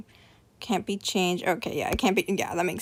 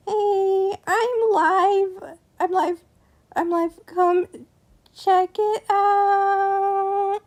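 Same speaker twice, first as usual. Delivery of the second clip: higher, cheery announcer singsong; singsong promo; 9 semitones higher, much slower than usual. Pretend. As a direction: pitch it up like a bright promo.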